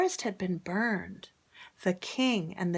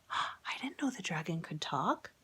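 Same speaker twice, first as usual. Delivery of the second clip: whisper; 8 dB quieter than usual. Real, not acted. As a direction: lean in and whisper it. Whispered aside to children.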